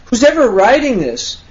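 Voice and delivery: ordinary talk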